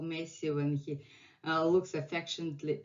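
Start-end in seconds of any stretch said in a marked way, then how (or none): none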